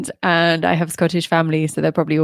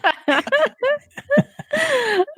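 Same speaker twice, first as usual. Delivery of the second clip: high embarrassed laugh. Real, not acted. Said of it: "Oh, wow".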